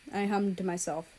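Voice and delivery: ordinary delivery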